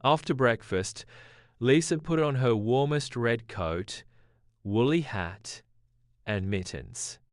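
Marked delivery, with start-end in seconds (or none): none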